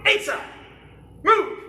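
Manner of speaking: high, loud command call; drill command shout. Real, not acted. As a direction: snap the command out to the class. Instructor calling drill commands.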